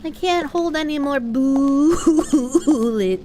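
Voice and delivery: ordinary talk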